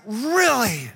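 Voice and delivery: drawn-out, put-upon complaining; exasperated disbelief. Retold or imitated. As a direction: ham up the traveller's indignation. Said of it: "And you're like".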